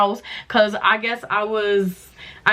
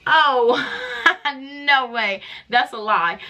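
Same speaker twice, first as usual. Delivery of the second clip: a shocked laugh that won't accept the news; disbelieving laugh; 3 semitones higher, slower than usual. Real, not acted. To the doctor, laughing off the positive covid result in disbelief. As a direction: laugh it off, half crying, in disbelief.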